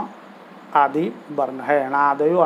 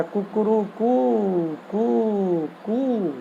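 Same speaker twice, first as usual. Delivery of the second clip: higher-pitched cock-a-doodle-doo, sung out slowly; rooster crow; 4 semitones higher, 4 dB quieter, much slower than usual. Retold or imitated. Teacher imitating the rooster the sages heard, drawn-out crow. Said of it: "मुर्गा जो है आवाज़ देता है".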